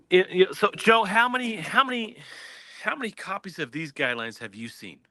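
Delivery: ordinary delivery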